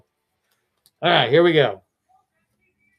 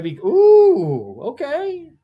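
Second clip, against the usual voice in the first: suspense; 12 semitones higher, 7 dB louder, slower than usual. Real, not acted. Pack opening suspense.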